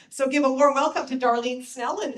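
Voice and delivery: loud welcome call. Real, not acted. Host calling for welcome.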